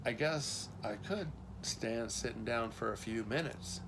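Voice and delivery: soft, understated reluctance; reluctant. A narrator's reading. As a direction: concede quietly, reluctantly. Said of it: "said Johnny reluctantly".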